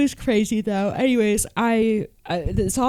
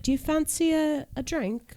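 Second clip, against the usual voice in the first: high, soft British lilt; beautiful British accent; 10 semitones higher, 4 dB quieter than usual. Retold or imitated. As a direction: sweetly imitate a British woman. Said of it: "her accent killed me".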